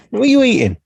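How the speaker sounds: high, fast, mumbled mimicry; mouth-full mimic. Mocking partner's full mouth.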